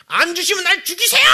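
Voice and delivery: put-on high, loud childish screaming demand; tantrum threat. Believer threatening God like a sprawled child, screamed tantrum. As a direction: act out a child throwing a full tantrum. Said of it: "아이들 아주 대자로 누워가지고".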